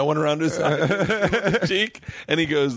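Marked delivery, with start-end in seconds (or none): none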